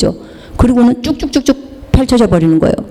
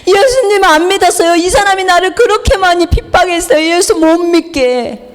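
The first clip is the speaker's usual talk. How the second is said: high-pitched, tearful pleading; imitated crying; 8 semitones higher than usual. Imitating a wife begging prayer for her husband, crying. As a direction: plead through mimicked sobs. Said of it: "막 우는 거예요".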